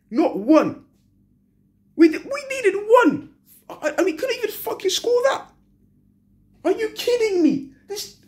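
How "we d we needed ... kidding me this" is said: can't believe it, pitched way up; exasperated. Real, not acted. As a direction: voice jumps much higher in disbelief. Fan exasperated after the loss.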